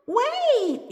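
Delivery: high, loud call to stop; high-pitched yell; yelling after her. A narrator's reading. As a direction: call out urgently after someone running off. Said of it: "yells Bearheart after her".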